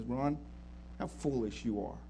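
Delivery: soft, low reproach; gentle rebuke. Acted. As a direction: chide softly, meaning to correct. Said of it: "Not mean, not to put me down".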